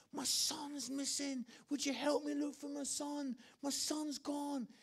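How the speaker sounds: acted desperate plea. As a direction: play a father begging people to help. Acting planted father's plea for help.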